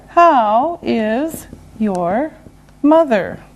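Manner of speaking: word by word; slow and deliberate. Shown as a demonstration. Teacher modelling a question about mother, slow and separated. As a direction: separate the words clearly and slowly.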